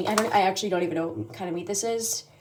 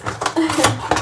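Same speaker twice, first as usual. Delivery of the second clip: bright burst of laughter; excited laugh; 9 semitones higher, 8 dB louder than usual. Real, not acted. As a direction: laugh out loud with glee. Excited over grocery haul.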